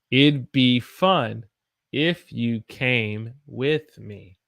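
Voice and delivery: very slow, deliberate sentence; very slow model. Shown as a demonstration. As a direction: stretch each word out for learners. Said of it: "I'll say it one more time".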